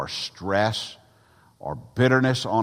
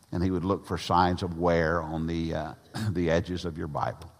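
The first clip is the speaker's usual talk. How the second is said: usual speaking voice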